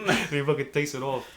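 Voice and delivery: normal talking voice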